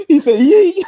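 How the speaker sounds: very high, loud laughing retelling. Real, not acted. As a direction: burst out through laughter. Laughing while retelling the scene.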